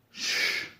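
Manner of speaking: breathy hush, no voice; whispered shush. Acted. Bernie silencing Todd mid-pitch, whispered shush. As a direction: hiss a soft shush.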